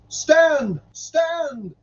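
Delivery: high-pitched cry, loud then falling back; dramatic high call, each word falling. A narrator's reading. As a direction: call after people who are fleeing. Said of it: "shall they cry".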